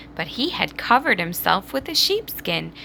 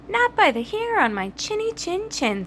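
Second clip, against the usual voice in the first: little pig; 12 semitones higher than usual. A narrator's reading. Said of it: "said the little pig".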